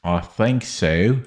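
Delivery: a light, slow put-on Australian accent. Chess streamer tries a silly Australian accent on a move, lightly. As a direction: drawl it out in mock Australian, rising at the end. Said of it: "I don't know what accent that was".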